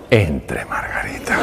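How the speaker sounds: whispered invitation. Retold or imitated. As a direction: hush it like a secret. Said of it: "doctor whispers her in". (susurro, como un secreto)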